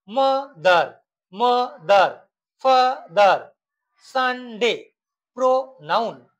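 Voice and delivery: মাদার, ফাদার, সানডে, প্রোনাউন each as two beats; broken in two. Shown as a demonstration. Teacher demonstrating disyllables, মাদার and the rest broken in two. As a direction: split মা-দার, ফা-দার, সান-ডে, প্রো-নাউন. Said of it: "দুবার ভেঙে উচ্চারণ করা".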